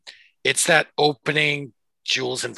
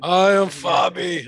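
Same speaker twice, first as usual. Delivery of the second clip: slow, higher silly put-on voice; 4 semitones higher, 5 dB louder, slower than usual. Friend teasing the group in a dopey put-on voice, slow and silly. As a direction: ham up a dopey character voice. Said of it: "Don't talk to me in that Mongoloid voice".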